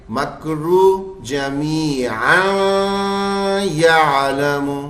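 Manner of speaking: slow chant, long ending of "जमीआ" unmerged with "यालमु"; wrong joined reading. Shown as a demonstration. Teacher tests students with wrong joining, "जमीआ" left unmerged before "यालमु". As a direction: hold "जमीआ" open, then a separate "यालमु". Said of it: "तो क्या ऐसे पढ़ेंगे?".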